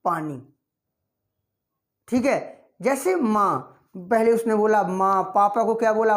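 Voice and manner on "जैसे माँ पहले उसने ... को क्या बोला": normal talking voice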